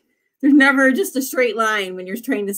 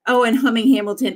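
ordinary talk, nothing marked

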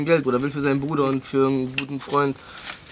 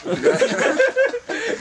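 loud high laugh; 5 dB louder than usual. Real, not acted. Big laugh at friend.

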